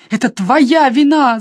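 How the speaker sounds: loud, slow, accusing shout; a sister shouting at her brother. A narrator's reading. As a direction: hurl the blame at him. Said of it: "закричала Нэнси".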